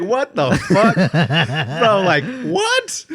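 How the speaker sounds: baffled retold reaction, cracking up; incredulous.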